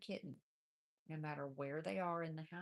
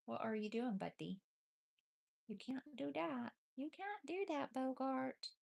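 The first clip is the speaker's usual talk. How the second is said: raised, sing-song scold of a kitten; pet-talk scolding; 8 semitones higher than usual. Real, not acted. Owner gently scolding the kitten Bogart in pet voice. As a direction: tell the kitten off in a high pet voice.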